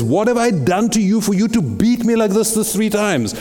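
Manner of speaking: voiced donkey. Donkey pleading with Balaam.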